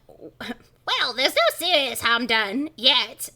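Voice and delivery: slow, put-on professor accent; Professor Shock. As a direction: draw it out in the harsh character voice. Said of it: "Professor Shock replies".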